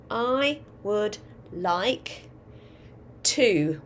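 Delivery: slow, word-by-word dictating; slow classroom dictation, each word spaced apart. Shown as a demonstration. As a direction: read it out slowly so children can write it.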